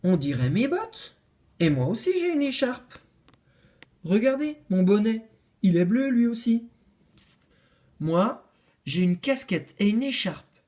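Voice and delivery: each insists it's for them; eager claims. Acted. Les animaux réclament la lettre, excités.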